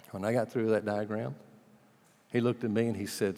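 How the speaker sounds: ordinary delivery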